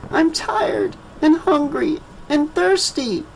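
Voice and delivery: put-on high, soft sniffly child voice; a worn-out little girl sniffling; tearful child. A narrator's reading. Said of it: "Latoya sniffled".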